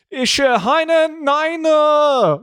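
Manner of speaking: high, slow, stammering panic in broken German; flustered job candidate faking German; panicked fake German. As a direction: squeak out nonsense German in a panic. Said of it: "And he was like".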